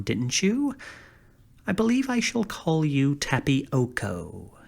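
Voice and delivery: ordinary delivery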